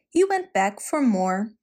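usual speaking voice

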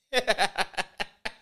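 high burst of laughter. Real, not acted. Laughing at fake call.

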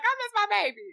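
high, whiny fake cry; mock crying aunt. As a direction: imitate a weepy auntie missing her baby. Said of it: "start crying like".

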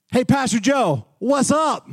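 mimicked high-pitched hail; insistent street greeting. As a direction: pester him with a high call-out. Street guy insistently greets him again.

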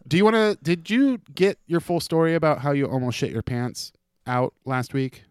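ordinary delivery

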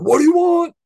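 high, loud yelled greeting; mock scream. Store greeting yelling at whoever walks in, loud mock shout. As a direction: yell it as the doorbell would. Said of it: "it's just screaming at you right away".

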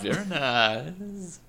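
put-on slow, drawn-out Norwegian accent. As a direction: stretch each word in a Norwegian accent. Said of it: "I gotta work on a Norwegian accent".

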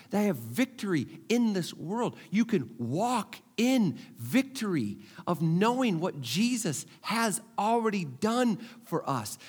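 ordinary talk, nothing marked

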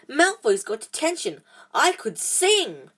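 high, slow delighted voice; gleeful. A narrator's reading. Hermione celebrating Malfoy's detention, high and gleeful. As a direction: savour the good news.

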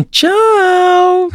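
very high, long-stretched singsong goodbye; imitated grandma's bye. Grandson mimics grandma signing off video, high drawn-out tchau. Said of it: "que é um tchauzinho dela, que ela não fala assim normalmente".

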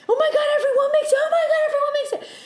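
very high, fast retold squeal; overjoyed disbelief. Dreamer told everyone makes it, squealing with amazement.

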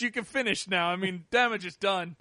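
bad British accent, politely letting them carry on; terrible British accent. Policeman fining someone caught in public, mock British accent. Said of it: "my terrible British accent".

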